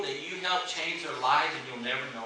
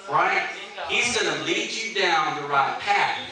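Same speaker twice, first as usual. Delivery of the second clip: full-force preaching; 4 semitones higher, 5 dB louder than usual. Real, not acted. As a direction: drive the point home to the congregation.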